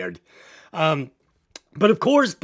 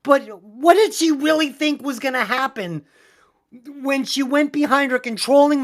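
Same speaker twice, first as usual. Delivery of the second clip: usual speaking voice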